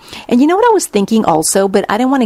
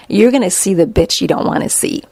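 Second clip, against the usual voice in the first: usual speaking voice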